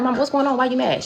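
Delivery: put-on a scared kid asking mom what's wrong; worried child.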